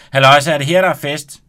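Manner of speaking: high, loud cheerful hello; calling out. Acted. Ronny arriving at the party, calling out loudly. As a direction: call in from the hallway.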